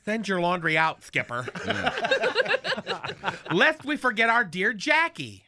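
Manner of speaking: usual speaking voice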